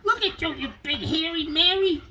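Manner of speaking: high, cartoony pet voice for the dog; voicing the dog. Owner voicing what his dog thinks of him, high and squeaky. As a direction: give the dog a squeaky little voice. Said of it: "Oh, she's like".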